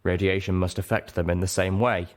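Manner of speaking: ordinary delivery, nothing marked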